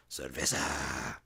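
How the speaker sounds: slow, low, slurred cerveza; mock drunk. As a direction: drawl it like you're already drunk. Drunk reply.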